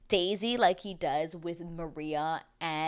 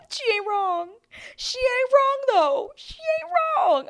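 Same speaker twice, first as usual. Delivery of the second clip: high squealing laugh through the words; words squeaked out through laughter; laughing hard; 6 dB louder, slower than usual. Real, not acted. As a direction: crack up as you agree. Laughing agreement with Rachel.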